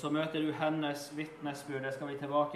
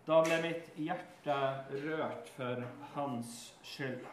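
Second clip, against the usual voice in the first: slow solemn title; slower than usual. Shown as a demonstration. Preacher announcing today's heading, slow and solemn. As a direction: lay the text's title down slowly. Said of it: "Overskriften i, for timen i dag".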